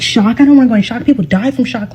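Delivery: high, panicky outburst reliving the fear; panicked. Retold or imitated. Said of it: "Like, I'm freaking out now".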